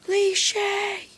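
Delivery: high, slow, eye-rolling drawl; mock the cliché sarcastically; sarcastic. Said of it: "and you're like".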